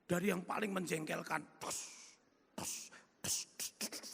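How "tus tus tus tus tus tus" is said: unvoiced quick tus tus of film frames; whispered flicking sound. Showing faces of employees flashing past, whispered tus sounds. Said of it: "Itu semua cepat sekali".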